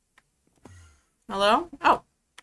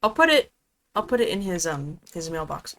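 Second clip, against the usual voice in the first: normal talking voice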